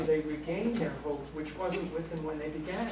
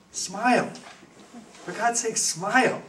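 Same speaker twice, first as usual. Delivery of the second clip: loud, raised plea to smile; urging smile; 6 semitones higher, 7 dB louder than usual. Real, not acted. Priest urging ministers, loud cry to smile. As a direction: lift it up, almost shouting smile.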